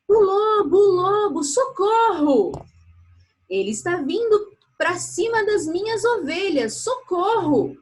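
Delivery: high, loud cry for help; shouting for help. Acted. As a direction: yell it at the top of your lungs. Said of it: "pôs-se a gritar com todas as suas forças".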